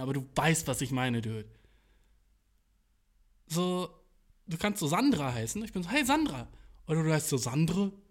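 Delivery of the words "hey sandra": cheerful greeting. Shown as a demonstration. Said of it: "schlechtere Laune, wenn ich [name] sage".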